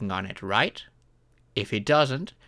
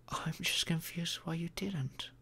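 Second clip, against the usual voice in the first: whispered, gentle and confused; hushed whisper; soft whisper; 5 semitones higher, 6 dB quieter than usual. Acted. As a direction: breathe it softly to your partner. Tender reassurance to upset partner.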